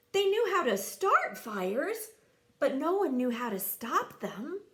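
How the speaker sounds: plain reading voice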